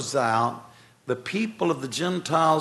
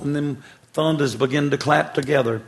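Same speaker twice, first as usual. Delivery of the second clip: ordinary delivery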